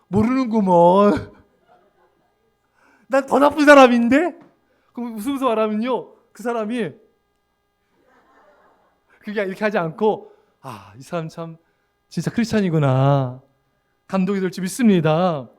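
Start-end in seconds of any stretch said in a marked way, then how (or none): 0.1-4.4 loud, amused self-mocking comeback; laughing reply. Believer answers an insult with a laughing admission. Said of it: "그때 딱 웃으면서". 10.6-13.4 low-pitched admiring murmur growing louder; impressed realisation. Pretend. Accuser realises the believer is sincere, low impressed voice. Accuser moved by humble reply.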